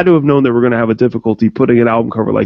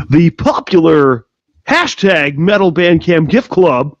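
slow, higher-pitched radio host hype; morning zoo voice; 5 semitones higher, slower than usual. Acted. Host naming the popular hashtag to the guest, morning zoo voice. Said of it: "that was my morning zoo voice".

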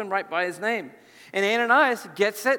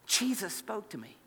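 suddenly much softer; hushed awe; 11 dB quieter than usual. Acted. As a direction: drop to a marvelling near-hush. Awed report after the exclamation.

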